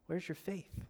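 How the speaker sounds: hushed question, imitating Jesus; quiet reproach. Jesus looks at the panicked disciples, quiet reproach. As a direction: ask it very softly, letting it sink in.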